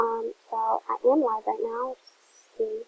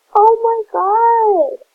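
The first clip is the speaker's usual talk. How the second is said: high, loud, drawn-out gasp of delight; gasping surprise; 9 semitones higher, 11 dB louder, much slower than usual. Real, not acted. Streamer opening a fan's gift, loud astonished gasp. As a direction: stretch it out, overwhelmed by the gift.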